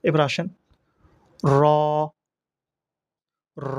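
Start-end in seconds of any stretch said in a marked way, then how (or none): 1.6-3.8 র as Arabic ra, rounded and held long; demonstrated pronunciation. Shown as a demonstration. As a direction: draw each র out into a steady "raw". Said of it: "এভাবে উচ্চারণ". (র গোল করে টেনে উচ্চারণ)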